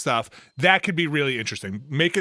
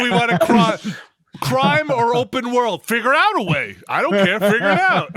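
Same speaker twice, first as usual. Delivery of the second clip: loud, high mock-executive demands; hammed-up bossy rant, pitched high; Andrew Wilson; 9 semitones higher, 7 dB louder than usual. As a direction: order staff about like a grasping boss. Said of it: "That's my Andrew Wilson impression".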